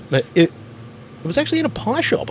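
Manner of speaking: ordinary talk, nothing marked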